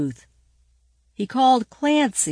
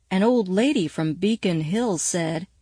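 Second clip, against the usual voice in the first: usual speaking voice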